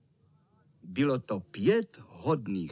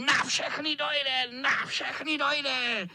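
very high, drawn-out squeal; high villain screech; shrieking tomcat; 10 semitones higher, slower than usual. Acted. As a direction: shriek it slowly, without extra volume. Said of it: "ječel kocour".

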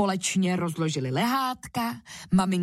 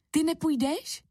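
higher-pitched, lightly voiced mum's question; mother asking; 9 semitones higher than usual. A narrator's reading. Mum to Ferda who stays behind, asking. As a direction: ask the child, voice lifted. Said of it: "ptala se maminka Ferdy".

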